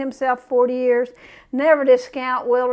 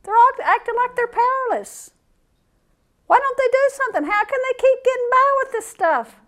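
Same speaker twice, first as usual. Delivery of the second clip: high, pleading whine of a fed-up believer; lamenting to the Lord, voice pushed way up; exasperated prayer; 9 semitones higher than usual. Retold or imitated. Preacher imitating his own weekly complaint to God, high and pleading. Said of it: "all week kept saying".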